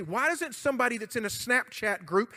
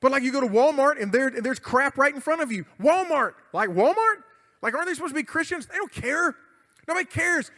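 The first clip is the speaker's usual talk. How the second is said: rising rant; 5 semitones higher, 7 dB louder than usual. Real, not acted. Ranting about Walmart.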